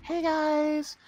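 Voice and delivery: high-pitched mock streamer intro; Jordy impression. Mimicking a friend's channel greeting, high impression. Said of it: "I can't do your voice".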